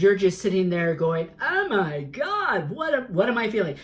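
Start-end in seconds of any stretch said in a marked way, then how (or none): 1.4-3.8 mock panic. Reader imitates a listener stuck in confusion, high and panicky. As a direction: play the overwhelmed listener frozen in confusion. Mocking someone frozen by their feelings.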